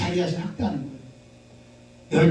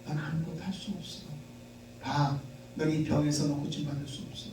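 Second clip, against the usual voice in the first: inner critic; 3 semitones lower, 11 dB quieter than usual. Retold or imitated. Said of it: "자기가 자기에게 뭐라고 말하냐면".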